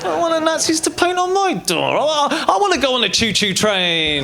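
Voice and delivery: put-on high, drawn-out childlike wail; mock pleading. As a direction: beg like a naive child, hammed up. Neutral people pleading, childlike wishes.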